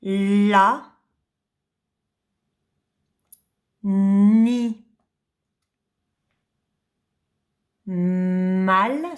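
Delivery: La, Ni, Mal split into separate slow syllables. Shown as a demonstration. Teacher sounding out a word for a child, one syllable at a time. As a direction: sound out each syllable with a pause. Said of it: "ce qui fait le mot".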